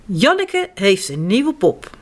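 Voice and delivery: usual speaking voice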